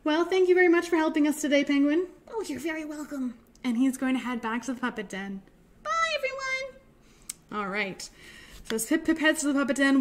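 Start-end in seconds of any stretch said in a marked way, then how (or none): 2.3-3.3 soft, quick little puppet voice; reply softly and quickly as a small puppet; penguin puppet. Acted. 5.8-6.8 penguin puppet. Acted. Penguin puppet says goodbye.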